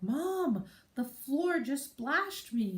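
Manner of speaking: put-on high, drawn-out little girl complaint; toddler voice. Mother quoting her daughter, high and put out. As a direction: mimic an indignant toddler. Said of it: "She'd be like".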